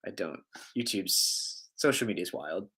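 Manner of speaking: ordinary talk, nothing marked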